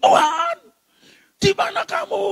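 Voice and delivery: very high, loud mock outcry at heaven; mock raging. Preacher imitating Christians railing at God, mock raging. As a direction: imitate a believer yelling in a fit. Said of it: "Terus ngamuk".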